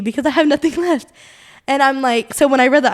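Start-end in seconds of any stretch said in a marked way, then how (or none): 0.0-1.1 rising, louder, voice catching on tears; a tearful cry for help, half laughing; emotional plea. Real, not acted. Talking to her listener about hard weeks, with a tearful plea. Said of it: "I'm getting emotional".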